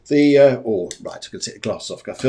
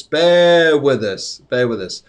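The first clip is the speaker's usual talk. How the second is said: drawn-out appeal; 2 semitones higher, 7 dB louder, slower than usual. Real, not acted. Storm overhead, asking patience.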